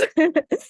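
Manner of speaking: short nervous giggle; nervous laugh. Real, not acted. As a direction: laugh off the nerves. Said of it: "I'm a little nervous".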